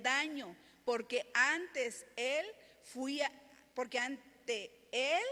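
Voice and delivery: loud, slow, declaimed reply; scripture proclamation. Acted. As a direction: proclaim Daniel's answer to the king. Reads Daniel's answer from scripture.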